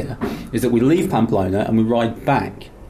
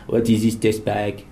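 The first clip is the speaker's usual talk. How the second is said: put-on French accent; slower than usual. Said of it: "with the accents".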